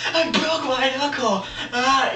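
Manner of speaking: high, slow whiny wail; mock crying. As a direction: imitate a crybaby wailing over an injury. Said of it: "he's crying".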